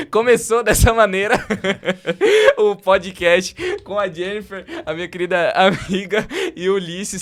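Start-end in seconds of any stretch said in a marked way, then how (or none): 0.1-2.6 loud, laughing through the words. Real, not acted. Rindo ao abrir o podcast. 2.6-4.6 slow, giggling through it; laughing. Real, not acted. Ainda rindo da abertura.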